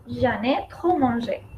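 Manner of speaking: slow, careful French with the pronoun en; quiet, slow French demo; slow model phrase. Shown as a demonstration. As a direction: model it slowly for the class. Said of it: "Entonces decimos".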